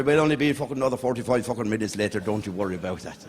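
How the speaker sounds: normal talking voice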